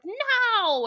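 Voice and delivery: high, loud dramatic no; mock cry of horror. Realizing her plan, high loud mock cry.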